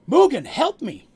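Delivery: high, loud cry for help; desperate yell. Acted. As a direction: yell to your friend for help. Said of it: "he yells".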